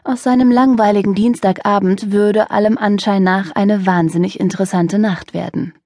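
ordinary delivery, nothing marked

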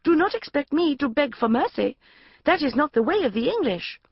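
melodramatic reading aloud. Acted. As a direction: read the purple prose with mock heroics. Hero's defiant reply in the novel.

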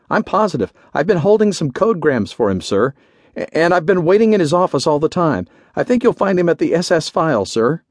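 high-pitched, earnest insistence; anxious orderly. A narrator's reading. Orderly defends his account to the general, anxious.

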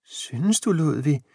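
put-on a gently reassured old wife; tender, comforted question. A narrator's reading. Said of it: "spurgte hun trøstet".

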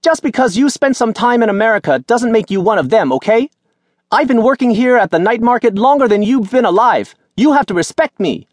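high, loud scolding market woman; scolding old vendor. A narrator's reading. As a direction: berate him like an elder.

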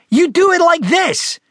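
put-on high, slow, insistent child's voice; loud declaration. Frederick showing off on the skateboard, bossy high declaration. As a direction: declare it slowly, pitched right up. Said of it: "he declared".

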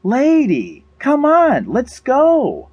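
high-pitched calling out; shouting to a dog. A narrator's reading. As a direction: call the dog to come along. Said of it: "Sandra shouted at the beautiful greyhound".

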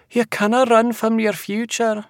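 high Scottish seer voice; amused seer. A narrator's reading. Seer calling after the departing man, high and knowing. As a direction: taunt him high from behind. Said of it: "Yolanda chuckled".

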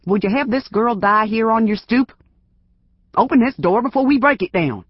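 put-on gruff old self. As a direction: threaten through the door, building to a yell. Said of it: "sounding more like his old self".

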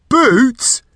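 loud, high, incredulous roar. A narrator's reading. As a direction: roar the question in disbelief. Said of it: "the voice roared".

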